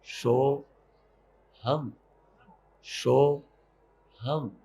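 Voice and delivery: soft, low so-ham with long gaps; hushed meditative mantra, one syllable per breath. Shown as a demonstration. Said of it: "बस इसी में अब मंत्र जोड़ लो".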